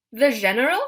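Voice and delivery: a mock French The General?; French accent. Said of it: "I'd said it with a French accent".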